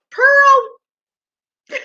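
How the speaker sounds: a very high, loud squeal of the name into a laugh; real giddy shriek then laughter; delighted squeal. Real, not acted. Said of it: "She just kinda threw me off with that".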